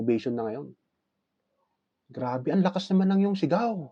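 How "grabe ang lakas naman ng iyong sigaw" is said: high, excited praise; amazed judges. Acted. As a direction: gush over the winning shout. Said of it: "sabi ng mga hurado ngayon".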